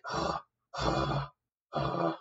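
low, soft breathy groans of a beaten man; pained groans. Retold or imitated. Defeated captain lying on the ground, low pained groaning. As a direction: groan like you're half dead on the ground. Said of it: "in pain and a lot of anguish".

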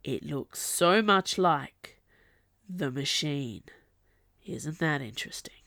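slow hushed whisper, trailing off; very low voice. A narrator's reading. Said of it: "said the Dark in a very low voice".

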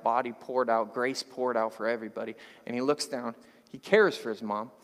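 usual speaking voice

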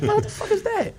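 very high incredulous question; mock bafflement. Retelling his confusion at the cop's word, high mock bafflement. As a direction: pitch it way up in comic disbelief.

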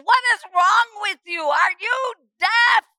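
put-on a frustrated young woman yelling at the deaf priest, high and loud; exasperated outburst. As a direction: blow up at the priest in disbelief.

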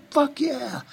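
imitating cheering locals. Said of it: "the people in the community are going".

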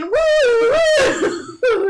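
very high loud siren wail; siren imitation. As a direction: wail like an ambulance.

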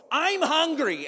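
put-on high-pitched whining child; whiny kid. Kid complains to parent at the grocery store, whiny. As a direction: whine it like a hungry kid. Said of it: "with the most whiny voice".

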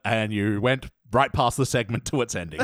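ordinary delivery

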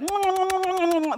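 a big warm fatherly smooch; big loving kiss. Shown as a demonstration. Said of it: "It was like".

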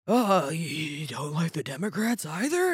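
slow, drawn-out surprised question; lightly voiced older person. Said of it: "especially older people, are very like".

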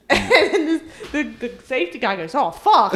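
laughing through the words. Real, not acted. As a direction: laugh it out while you tell it. Laughing while retelling shock.

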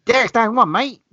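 high, loud New Zealand shout; New Zealand accent. Retold or imitated. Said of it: "a New Zealand accent".

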